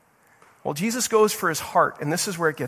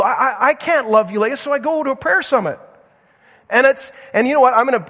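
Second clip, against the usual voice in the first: high, loud, fervent preaching; preaching at full force; 9 semitones higher, 6 dB louder than usual. Acted. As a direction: drive it home to the congregation. Preacher exhorting the congregation.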